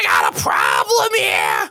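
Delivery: low, loud, slow guttural parrot shout; guttural Iago. Shown as a demonstration. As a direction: growl it from the throat like Iago. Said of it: "that guttural sound like Iago".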